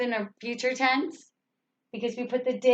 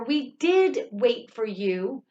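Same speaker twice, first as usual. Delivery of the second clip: slow, rising, leaning on "did"; exaggerated stress on did; slower than usual. Shown as a demonstration. Teacher answering an accusation, stressing "did". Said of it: "I'm going to exaggerate".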